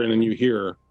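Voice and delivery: ordinary talk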